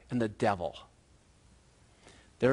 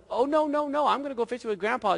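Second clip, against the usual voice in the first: put-on high, hurried little-girl protest; storyteller's pushy little sister; Sally objecting; 9 semitones higher than usual. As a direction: rattle off a bossy refusal, pitched up. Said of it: "And Sally goes".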